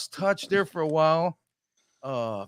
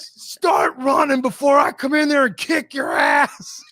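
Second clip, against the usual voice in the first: loud, higher mock yell at the batter; shouted threat; 8 semitones higher, 4 dB louder than usual. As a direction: bark it from the outfield. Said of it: "He turns around".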